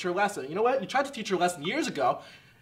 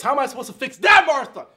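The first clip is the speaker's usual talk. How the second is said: loud, higher exasperated shout; angry outburst; 5 semitones higher, 7 dB louder than usual. Acted. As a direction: yell it at your ex.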